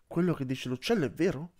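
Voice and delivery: ordinary delivery, nothing marked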